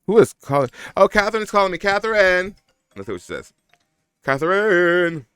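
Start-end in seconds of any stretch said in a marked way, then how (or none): 0.1-2.6 high, loud excited greeting; light up at the call. Real, not acted. 4.2-5.3 slow, high drawn-out call. Real, not acted. Calling out to friend.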